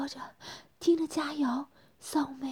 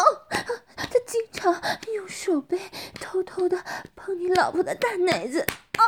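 higher wife's voice broken by moans; moaning while speaking; 9 semitones higher, 8 dB louder than usual. Acted. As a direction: let moans interrupt the words, building louder. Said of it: "一边呻吟着说". (一边呻吟一边说，越来越高亢)

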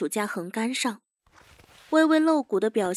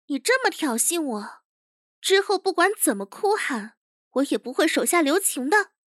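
higher-pitched, indignant warning; higher voice, offended and menacing; stung young chief threatening; 5 semitones higher than usual. Acted. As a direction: warn her as a provoked boyish boss.

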